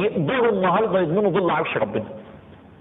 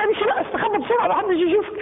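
much higher, pressing, hurried urging; urgent hurry; 11 semitones higher than usual. Pretend. Giver urging the poor man, higher and hurried. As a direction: shoo him off quickly before anyone sees.

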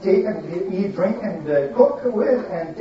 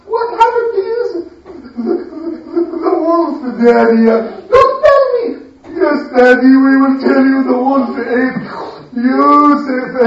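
very high hammed-up sobbing; crying without tears; 5 semitones higher, 4 dB louder than usual. Sons faking tears to Daddy at night, high mock sobbing. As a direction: pretend to weep, whining and pleading. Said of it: "Crying without tears".

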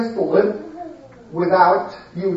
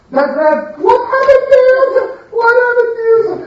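very high, fast hammed-up wail; fake sobbing question; 5 dB louder, faster than usual. Third brother pretending grief to father, high hammed wail. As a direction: squeal it out like a faking brother. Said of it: "And the third".

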